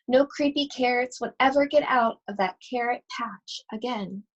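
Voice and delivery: usual speaking voice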